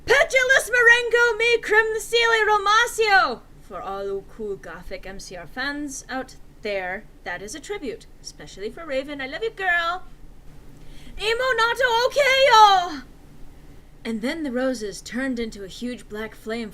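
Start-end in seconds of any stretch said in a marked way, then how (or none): 0.0-3.4 very high, loud screamed incantation; screamed spell. Acted. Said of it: "Then he screamed". 9.2-10.0 excited shout-out. Acted. Shout-out to friend Raven. 11.2-13.0 very high, loud chanted incantation; screamed spell. Acted. Spell cast.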